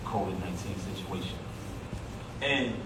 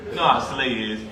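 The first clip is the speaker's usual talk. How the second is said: ordinary delivery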